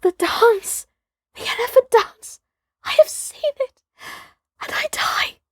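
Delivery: high excited cry that fades to a whisper; cried faintly. Acted. Toomay crying out to Peterson Sahib, then whispering faintly. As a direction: gasp it out, spent, then whisper the last words. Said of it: "cried faintly".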